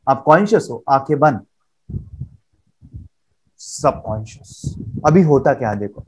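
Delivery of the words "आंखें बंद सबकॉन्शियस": hushed. Shown as a demonstration. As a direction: drop to a near whisper on the last word. Teacher dramatising closed eyes. (फुसफुसाकर, धीमी आवाज़ में)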